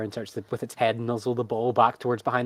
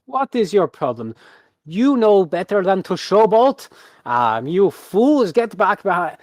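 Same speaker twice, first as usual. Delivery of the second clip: high, loud acted reprimand; scolding ringmaster; 7 semitones higher, 8 dB louder than usual. Ringmaster orders misbehaving animals back, loud indignant showman. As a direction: berate them in a projected stage voice.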